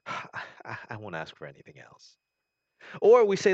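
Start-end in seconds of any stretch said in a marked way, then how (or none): 0.1-2.2 soft, sighing promise; resigned quiet vow to God; sighing plea. Acted. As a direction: trail off softly after a sigh. Prayer trails off after sigh.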